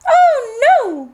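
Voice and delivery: high, dismayed goat cry; alarmed middle goat. Acted. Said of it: "said the billy goat".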